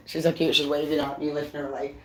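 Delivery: low, hushed aside; fondly, under the breath; soft fond aside. Real, not acted. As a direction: drop to a soft, low murmur. Aside about a cute animal.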